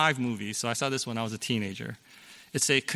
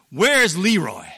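higher, louder menacing demand from the movie bad guy; threatening villain; 5 semitones higher, 7 dB louder, slower than usual. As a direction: loom over him and demand it. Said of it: "he very threateningly asks the father".

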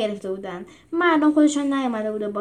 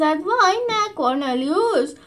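higher, louder cry of dismay; wailing; 8 semitones higher, 3 dB louder, slower than usual. Acted. Lucia crying out as Cornelius is struck, wailing. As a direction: cry out as a friend falls. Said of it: "لوسیا زار زد".

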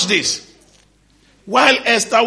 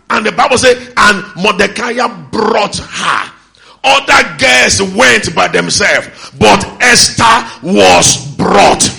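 louder and slow, every word driven home; preaching at full force; 3 semitones higher, 8 dB louder, slower than usual. Acted. Preacher driving his point home to the church, slow forceful preaching. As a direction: hammer the point to the congregation.

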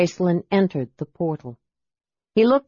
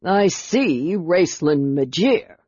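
slow, grudging reply; mildly glowering, deliberate answer; slower than usual. A narrator's reading. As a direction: draw the name out with stern disapproval.

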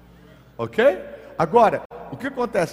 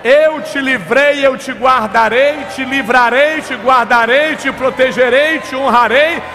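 slow, much higher, loud divine promise; God's voice, proclaiming; 7 semitones higher, 11 dB louder, slower than usual. Acted. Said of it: "Ele disse". (Deus proclamando em alta voz, lento)

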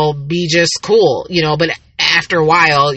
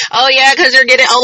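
higher-pitched imitation of a needling sister; mock teasing; 6 semitones higher, 4 dB louder than usual. Sister blaming every ache on age, mock teasing. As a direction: pounce on it, teasing in a raised pitch.

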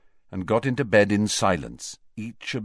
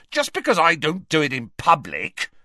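raised, indignant defence; angry shouting; 7 semitones higher than usual. Acted. Ron defending himself against his sister's taunts, angrily. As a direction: protest hotly, voice lifted. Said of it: "Ron roared".

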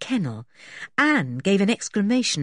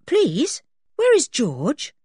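pleading dog; 9 semitones higher, 4 dB louder, slower than usual. Acted. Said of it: "as if he were saying".

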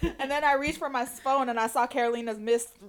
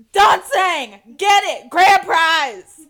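cheering; 4 semitones higher, 10 dB louder, much slower than usual. Retold or imitated. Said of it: "I was like".

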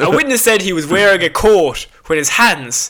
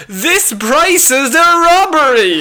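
slow, higher, laughing complaint; mock indignant complaint; 7 semitones higher, slower than usual. Robber complaining about the price, slowly. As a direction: protest the price with mock outrage.

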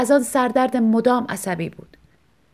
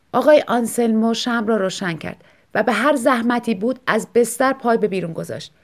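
usual speaking voice

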